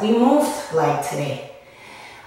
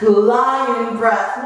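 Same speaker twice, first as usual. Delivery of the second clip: long breathy roaring exhale, then the words; lion breath. Shown as a demonstration. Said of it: "Goes like this".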